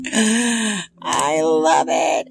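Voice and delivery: slow, drawn-out gasp of awe; awed delight. Retold or imitated. Vlogger reliving her first look at the deck, slow awed delight. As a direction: let the words melt out, lovestruck. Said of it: "And I'm just like".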